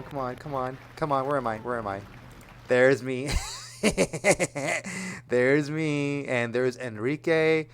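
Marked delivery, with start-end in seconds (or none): 2.7-7.7 louder, delighted at spotting himself; thrilled to finally find himself on screen; excited find. Real, not acted. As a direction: point it out with a laugh in the voice. Finally spots himself in footage.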